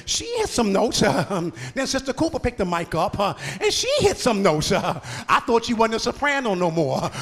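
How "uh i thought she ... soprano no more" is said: ordinary talk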